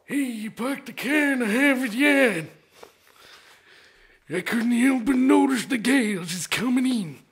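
high New England accent, hammed up; a salty old New England dog; gruff old fisherman. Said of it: "spiteful, waspy, northeasterner. And go".

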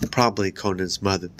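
plain reading voice

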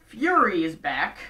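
usual speaking voice

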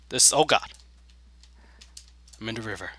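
ordinary talk

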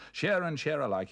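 loud, high outburst; crying out. A narrator's reading. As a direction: cry it out with reckless cheer. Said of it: "he cried".

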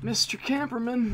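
high, loud singsong call of the name; mocking call. Real, not acted. Gamer taunting a camping enemy, high mocking call. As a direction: sing out Camperman high to taunt him.

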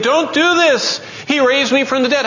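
very high, impassioned plea; frantic protest. Acted. Lazarus protesting Jesus' crucifixion, frantic high cry. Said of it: "jumping up and down saying".

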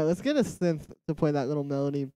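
normal talking voice

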